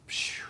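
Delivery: a soft unvoiced phew of amazement; whispered phew. Real, not acted. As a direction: blow the air out, amazed. Mind blown by the story.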